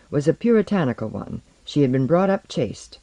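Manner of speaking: plain reading voice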